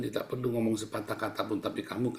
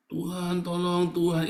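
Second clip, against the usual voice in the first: higher-pitched imitation of a plea; pleading prayer; 7 semitones higher, 3 dB louder, slower than usual. Preacher imitating a believer in trouble, pleading. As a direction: mimic someone calling on God for help.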